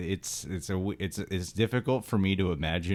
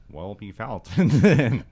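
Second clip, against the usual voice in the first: Bill Hader impression; 4 semitones higher, 10 dB louder than usual. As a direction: stretch it out, louder, as a comic impression. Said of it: "it's also my Bill Hader impression".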